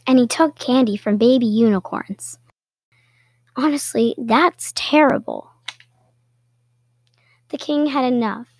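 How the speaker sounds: usual speaking voice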